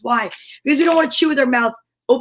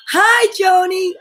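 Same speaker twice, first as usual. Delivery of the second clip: high, drawn-out delighted hello; excited greeting; 7 semitones higher, 4 dB louder, slower than usual. Real, not acted. Host greeting a viewer by nickname, high and delighted. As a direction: call out a slow, high, happy hello.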